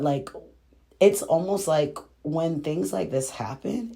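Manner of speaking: usual speaking voice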